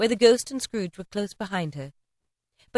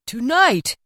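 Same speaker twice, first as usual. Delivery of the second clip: higher, louder startled question; shocked cry; 5 semitones higher, 5 dB louder than usual. A narrator's reading. Scrooge crying out to the ghost, startled. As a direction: recoil at the word. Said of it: "cried Scrooge".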